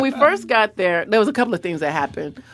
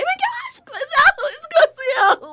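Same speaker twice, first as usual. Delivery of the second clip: much higher, slow squeal of a starstruck fan; 3 dB louder, slower than usual. Retold or imitated. Fans in Beverly Hills spotting Glozell, high squealing. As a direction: squeal her name like a fan spotting a star.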